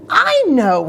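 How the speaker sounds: light up with the idea; sudden idea. Acted. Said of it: "he thought".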